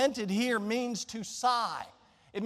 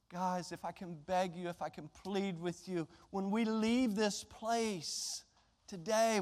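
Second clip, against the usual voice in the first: much softer, earnest and low; soft pleading; 3 semitones lower, 8 dB quieter than usual. Real, not acted. Preacher pleading with his congregation, much softer. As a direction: beg them gently, almost hushed.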